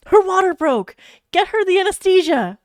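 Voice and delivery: urgent nurse calling for help; nurse crying out. A narrator's reading. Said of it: "a nurse had cried".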